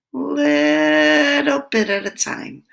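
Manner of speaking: high and slow, showing a tiny sip; slow high demo. Shown as a demonstration. Teacher showing children how to sip, slow and high. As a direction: draw it out gently, little by little. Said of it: "See how he's sipping the water?".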